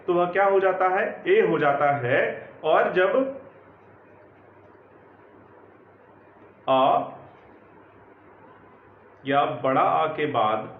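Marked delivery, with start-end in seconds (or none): 2.6-10.7 slow, low, word by word with long pauses; slow dictation. Acted. Teacher dictating the rule to students, slow and measured. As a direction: spell it out for them to write down.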